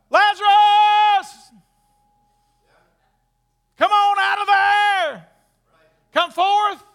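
high, full-voiced drawn-out shout; loud shout. Jesus calling Lazarus out of the tomb, loud shout. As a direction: shout it long to raise the dead. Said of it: "That's a shout".

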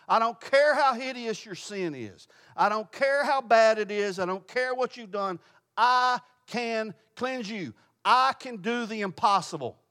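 high, forceful preaching declaration; God's emphatic assurance. Acted. Preacher voicing God to a sinner this morning, emphatic assurance. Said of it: "This is God's way of saying to someone this morning".

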